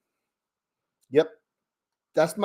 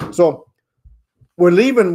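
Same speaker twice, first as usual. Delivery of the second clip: ordinary talk, nothing marked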